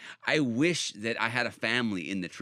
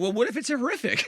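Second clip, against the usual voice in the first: laughing, much higher, louder challenge; incredulous outburst; 13 semitones higher, 4 dB louder than usual. Real, not acted. Challenging believers' comfort, high and incredulous. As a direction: burst out with disbelief.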